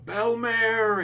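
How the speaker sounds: a high, loud cry of her name; a sudden high, loud wail of grief; anguished cry. Retold or imitated. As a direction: call out to her as she falls. Crying out the mother's name.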